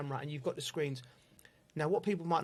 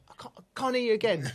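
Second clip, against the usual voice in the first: mock deaf taunt; 6 semitones higher, 4 dB louder, faster than usual. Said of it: "and I say".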